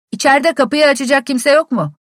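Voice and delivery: loud, high call up to the window; shouting back. A narrator's reading. Visitor at the locked door shouts up to the servant. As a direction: holler through the snow to be heard. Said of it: "diye bağırdım".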